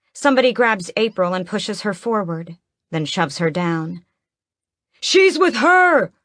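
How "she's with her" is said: very high, slow, loud accusing cry; villager exclaiming. Acted. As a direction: shriek the accusation in a strange voice. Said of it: "the man who did it exclaims".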